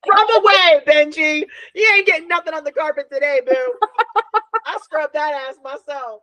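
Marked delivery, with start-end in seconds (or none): 0.1-3.7 loud, high, fast cheer to a dog. Retold or imitated. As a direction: egg the dog on with proud glee. Cheering on a dog named Benji.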